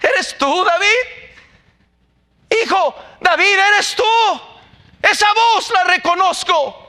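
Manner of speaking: high, loud, longing cry of recognition; preacher voicing Saúl crying out to David, high and loud; Saúl calling out. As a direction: call out as the old king hearing his son.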